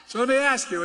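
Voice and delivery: normal talking voice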